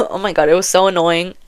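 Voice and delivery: usual speaking voice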